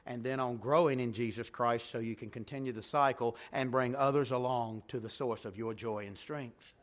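ordinary delivery

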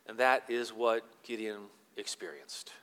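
ordinary talk, nothing marked

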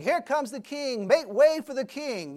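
loud, high herald's cry; herald proclaiming. Retold or imitated. A herald calls the crowd to make way for the king. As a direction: announce the king's arrival to the crowd. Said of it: "he's proclaiming out".

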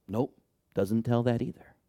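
ordinary delivery

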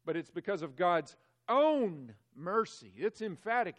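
loud, insistent preaching; it's not us, it's God's own mercy, forcefully; emphatic. Acted. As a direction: drive it home with force. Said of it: "The text is emphatic".

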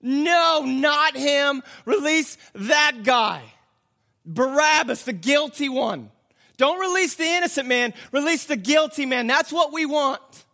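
high, forceful shouts of refusal; crowd's loud demand. Acted. Crowd rejects Pilate's offer, loud insistent demand. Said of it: "they're like".

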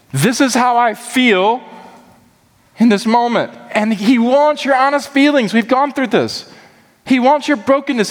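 impassioned preaching. Real, not acted. Preacher urging the church to bring God honest feelings, impassioned. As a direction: preach it with rising fervour.

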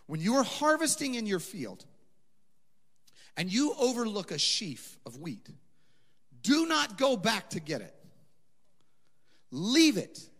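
high, loud preaching of the verse; preached scripture reading. Acted. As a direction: proclaim it with rising force. Reads scripture with rising force.

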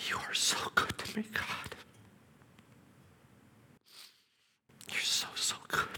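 soft breathy whisper of thanks; whispered prayer. Retold or imitated. Father thanking God at the bedside, whispered.

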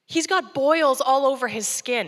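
ordinary talk

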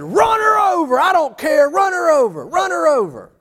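frantic, high full-force shout; emotionally charged yell. Retold or imitated. Dad on his feet at daughter's softball game, emotionally charged shouting. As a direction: yell it like a worked-up parent from the stands. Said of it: "all emotionally charged in the game".

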